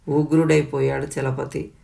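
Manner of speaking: ordinary delivery, nothing marked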